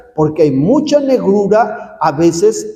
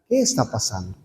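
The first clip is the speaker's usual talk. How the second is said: gentle asking; 8 semitones lower, 10 dB quieter, faster than usual. Retold or imitated. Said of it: "Entonces María le pregunta".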